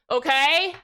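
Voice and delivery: ordinary talk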